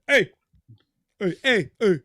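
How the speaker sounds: high, loud mimicked ad-lib calls. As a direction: punch out each call like the singer. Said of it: "that's my [name] impression".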